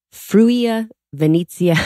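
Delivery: slow, careful "Fruia Venezia", stressing FRU; sounding out "Fruia Venezia" the Italian way; attempted Italian pronunciation. Shown as a demonstration. Podcast host attempting an Italian region name, slow careful try. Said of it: "I'm not even gonna say this right".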